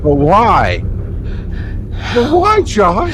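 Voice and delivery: loud high hammed-up despair; melodramatic anguish. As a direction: overwrought cry of why. Mock dramatic anguish.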